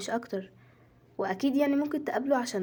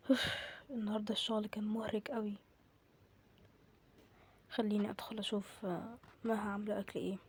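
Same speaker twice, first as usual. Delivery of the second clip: acted tiredness; 10 dB quieter than usual. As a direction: let the voice sag after work. Coming home worn out from work.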